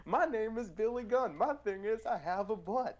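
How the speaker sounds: high dopey wrestler boasts; mocking wrestler voices. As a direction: send up the dumb gimmicks. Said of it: "here you go".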